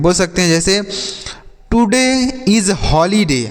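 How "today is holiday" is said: very slow and higher, words spaced; slow teacher demo. Shown as a demonstration. Teacher reading an example slowly to students. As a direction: read the sentence slowly for learners. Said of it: "आज छुट्टी है".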